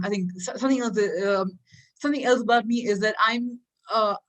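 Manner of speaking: usual speaking voice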